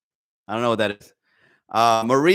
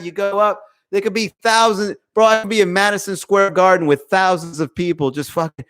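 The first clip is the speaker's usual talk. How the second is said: ordinary delivery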